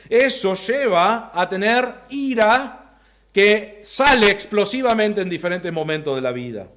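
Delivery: ordinary talk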